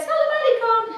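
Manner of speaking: high, light goodbye voice; lightly voiced old lady blessing; old woman's farewell. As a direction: salam alaikum said sweetly.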